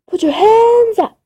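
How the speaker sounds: high, loud police command; sheriff's shout. Acted. Sheriff bursting in, shouting at the ghost, loud and high. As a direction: bark it as a cop storming in.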